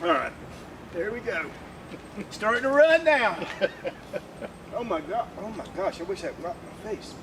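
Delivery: high, breathless and strained; gasping between words; choking on tear gas. Real, not acted. Just walked through pepper gas.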